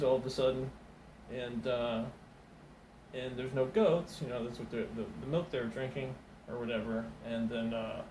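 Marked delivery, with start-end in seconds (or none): none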